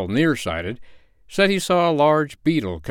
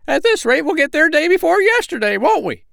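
very high, loud piping grumble; indignant little bird voice; complaining sandpiper; 6 dB louder than usual. A narrator's reading. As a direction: squeak in indignation as a tiny bird. Sandpipers complain about the backward crab.

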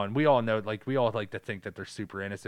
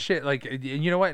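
ordinary talk, nothing marked

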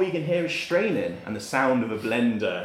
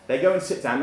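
ordinary talk

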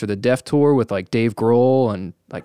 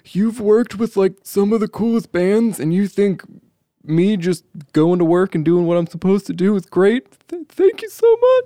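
much higher, rising into a choked squeal; overwhelmed gratitude; 9 semitones higher than usual. Retold or imitated. Tech thanks a famous engineer for the praise, in overwhelmed high gushing. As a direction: gush like you're about to cry. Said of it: "I was like".

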